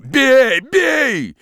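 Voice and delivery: high, loud ferocious shout. Acted. Peasant daring the forester to strike, ferocious shout. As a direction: dare him to hit you.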